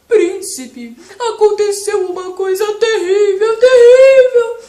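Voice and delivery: loud, high wailing lion; crying lion. Acted. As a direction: wail it out like a desperate lion. Lion cries out in distress.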